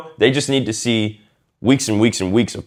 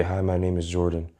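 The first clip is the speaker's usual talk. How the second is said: much softer and lower, barely audible; nervous low intro; 5 semitones lower, 10 dB quieter than usual. Shown as a demonstration. Coach showing a weak introduction, very soft. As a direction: say your name like you'd rather not be heard. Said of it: "you're speaking very low".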